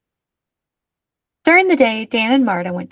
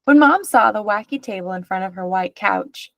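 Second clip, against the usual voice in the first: usual speaking voice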